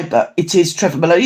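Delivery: ordinary delivery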